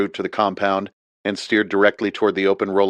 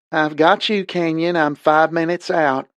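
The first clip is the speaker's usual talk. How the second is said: higher southern drawl over a radio; a female pilot, southern, calling back; higher voiced southern pilot on the radio; southern woman's accent; 9 semitones higher, slower than usual. A narrator's reading. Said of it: "a female voice with a southern accent".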